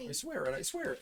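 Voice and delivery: high, soft squeaky oath; mock pleading. Co-host echoing the protest, soft high mock plea.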